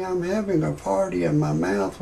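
usual speaking voice

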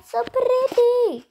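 high, stretched-out gush; slow squeal of admiration; odd drawn-out pretty. Real, not acted. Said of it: "Why did I say pretty just now".

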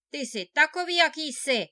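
loud, harsh scolding of a poor brother; angry rebuke. A narrator's reading. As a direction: snap at him in a loud rebuke. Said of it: "Obořil se naň bratr".

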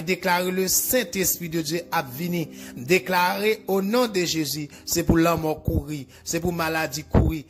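usual speaking voice

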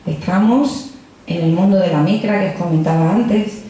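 ordinary delivery

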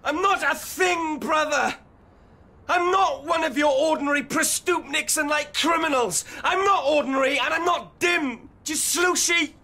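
high, shouted protest; indignant outburst. Acted. Youth refusing to be used by the activists, high indignant shout. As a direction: shout it high, insisting on every denial.

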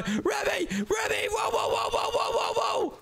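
high frantic screams; screaming. Acted. Calling for missing rat Remy, frantic screaming. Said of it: "Why is my brother screaming?".

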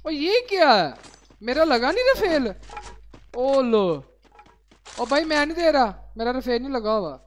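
loud startled complaint; surprised. Real, not acted. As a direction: react loudly in disbelief. Surprised at game glitch.

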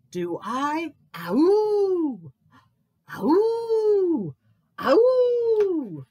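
high, loud drawn-out howl; long howls falling at the end; awful howl. A narrator's reading. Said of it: "he heard an awful howl".

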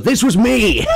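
high, gloating villain, laughing; high-pitched mock villain boasting of his scheme; evil villain voice. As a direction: gloat like a cartoon baddie. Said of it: "pretends to be evil organizer".

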